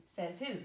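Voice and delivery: SAYS WHO, two slow stressed beats; spondee stress. Shown as a demonstration. Teacher giving a spondee example, both words of says who stressed. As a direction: equal weight on "says" and "who". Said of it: "is stressed, stressed".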